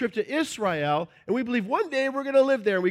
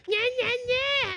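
high, sing-song yeah yeah yeah; mocking agreement. Crowd jumping on the bandwagon, mocking agreement.

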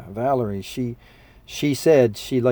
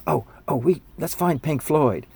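put-on eager kid; 4 semitones higher than usual. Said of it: "one of 'em goes".

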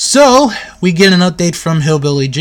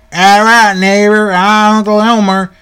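imitated Uncle Elmer; 3 semitones higher, 4 dB louder than usual. Voices Uncle Elmer's hearty reply.

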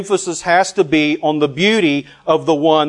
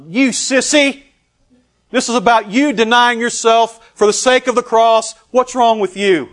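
high, loud scolding; hammed-up outraged rebuke; mock berating; 9 semitones higher, 7 dB louder than usual. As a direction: chew out a reluctant recruit. Acted berating of a reluctant believer.

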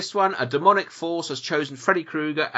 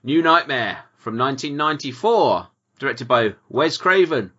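ordinary talk, nothing marked

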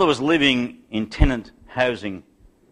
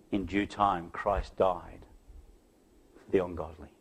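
hushed, low preaching with pauses; soft, measured pastoral appeal; solemn sermon close; 7 semitones lower, 9 dB quieter than usual. Acted. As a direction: bring the congregation to a reverent close.

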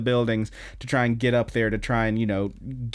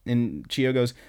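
plain reading voice

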